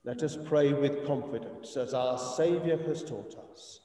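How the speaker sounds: ordinary talk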